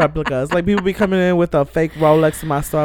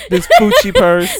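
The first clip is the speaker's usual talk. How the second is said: slow, louder mocking echo of This Gucci purse; 4 semitones higher, 6 dB louder than usual. Teasing her friend's story, slow mocking repeat. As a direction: repeat it back slowly, teasing.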